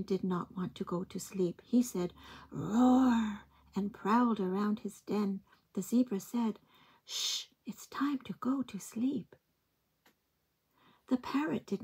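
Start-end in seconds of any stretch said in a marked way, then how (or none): 2.5-3.4 a playful read-aloud roar; mock lion roar. Lion's roar in story. 7.0-9.3 soft whispered shushing; whispered hush. Acted. Zebra hushing, whispered.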